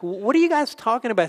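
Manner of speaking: high-pitched, puzzled question; innocently curious. Retold or imitated. Jesus acted asking travellers, feigning ignorance.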